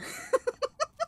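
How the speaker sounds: high rising giggle. Real, not acted. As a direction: break into a squeaky laugh. Laughing after bit.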